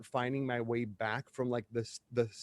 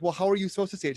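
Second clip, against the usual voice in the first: higher exasperated comeback; challenging retort; 5 semitones higher, much faster than usual. Retold or imitated. Speaker answering a critical mom, raised challenging retort. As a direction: throw the question back at her. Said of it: "Like, I'm like".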